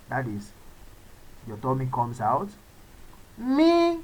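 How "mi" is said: "Mi" high and level. Shown as a demonstration. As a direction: pull the tummy in and lift "Mi". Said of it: "your tummy goes inside".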